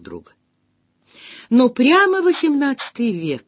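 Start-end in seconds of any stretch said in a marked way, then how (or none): 1.5-3.4 bright delighted exclamation. A narrator's reading. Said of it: "с восторгом сказала я".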